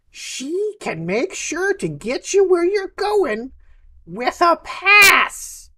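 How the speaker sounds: high, swooping merchant voice; excitable map seller. Acted. Map seller recommending a contact for safe passage, animated.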